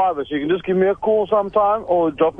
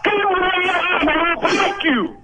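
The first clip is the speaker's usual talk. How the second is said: barbarian; 10 semitones higher, much slower than usual. Acted. Threatening the caller.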